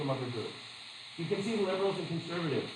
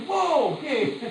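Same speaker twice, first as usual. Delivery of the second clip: mock amazement; 10 semitones higher, 5 dB louder, slower than usual. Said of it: "Yeah, it's like".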